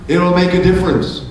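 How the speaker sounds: ordinary talk, nothing marked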